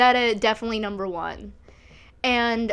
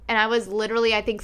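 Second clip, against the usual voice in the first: ordinary talk, nothing marked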